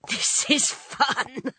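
high, drawn-out, teasing delight; slow high-voiced relish; gleeful wife. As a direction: sing out your enjoyment slowly, pitched high. Wife enjoys husband carrying bags.

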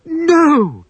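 Wendy hearing of the bomb; gasp. Acted. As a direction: cry out in horror. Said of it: "gasped Wendy".

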